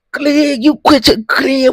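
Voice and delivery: high, loud shriek at Glenn; hammed-up shrill wife yelling her husband's name; mock shrieking wife. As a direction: screech his name in mock panic. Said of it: "That's how she said it".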